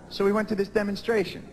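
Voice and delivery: ordinary talk, nothing marked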